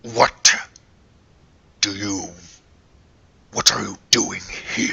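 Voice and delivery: hushed, suspicious whisper; whispered creature question. Acted. Dog creature questions group.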